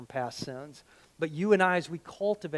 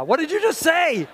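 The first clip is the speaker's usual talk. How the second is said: much higher, louder mock outrage; mock indignant; 11 semitones higher, 8 dB louder than usual. Preacher to the congregation, mock indignant and loud. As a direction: pretend to be scandalised. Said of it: "reacting to the blah blah".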